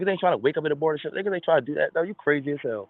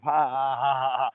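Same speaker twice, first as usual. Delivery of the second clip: imitated laughter; slower than usual. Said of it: "Everybody gonna laugh".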